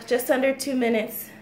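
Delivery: ordinary talk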